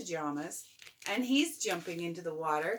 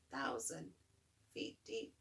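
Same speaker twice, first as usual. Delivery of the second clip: whispering; 4 semitones lower, 10 dB quieter than usual. A narrator's reading. Hushed aside in story.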